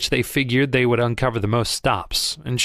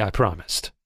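ordinary delivery

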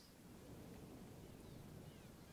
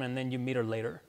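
usual speaking voice

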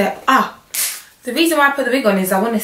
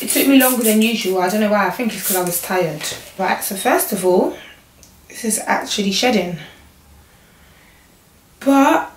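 usual speaking voice

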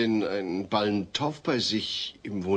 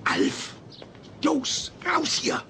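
hissed order under the breath; frantic stage whisper to the alien; urgent whisper; slower than usual. Acted. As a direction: shoo him out in a whisper. Sneaking Alf out, visitor outside.

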